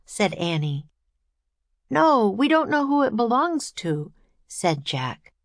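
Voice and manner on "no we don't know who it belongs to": high, worried objection; protesting boy. A narrator's reading. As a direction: object firmly with the voice raised high. Brother objects to climbing.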